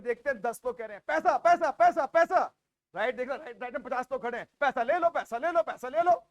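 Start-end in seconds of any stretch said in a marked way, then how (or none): 1.1-2.4 louder, higher chant of "पैसा"; a crowd on the left calls for money, loud and chanting; crowd calling for money. Retold or imitated. 4.6-6.2 louder calls, each rising at the end; the right-hand crowd offers money, calling out loudly; vendor-like calling. Retold or imitated.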